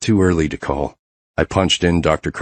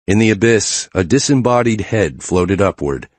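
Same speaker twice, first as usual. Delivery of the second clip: ordinary delivery, nothing marked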